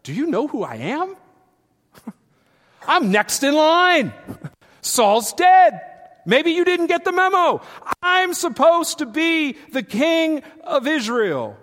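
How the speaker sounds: hammed-up shouting at the crowd; mock entitled tantrum. Imagined David yelling at people, indignant hammed-up shout. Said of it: "yelling and screaming at people saying".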